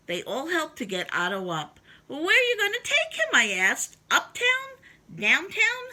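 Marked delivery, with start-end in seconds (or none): 2.1-5.9 high, fast, excited questions; eager questions. Acted. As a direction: rattle off the guesses keenly. Boy eagerly asks firemen.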